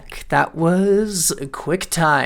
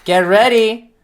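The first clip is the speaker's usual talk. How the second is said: hyped; 6 semitones higher, 5 dB louder than usual. Real, not acted. Hyping next film.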